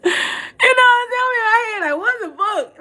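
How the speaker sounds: high squealing laugh through the words; laughing so hard the voice goes high. Real, not acted. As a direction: crack up while telling it. Laughing retelling the fright.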